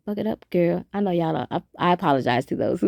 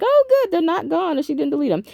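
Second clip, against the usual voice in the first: relieved delight; 10 semitones higher than usual. Retold or imitated. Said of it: "I'm like".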